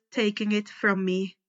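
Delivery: plain reading voice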